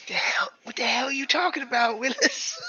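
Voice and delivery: high, loud, squeaky mock whisper; exaggerated stage whisper. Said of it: "I whisper back".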